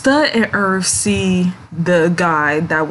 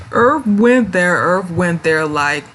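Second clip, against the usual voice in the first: ordinary delivery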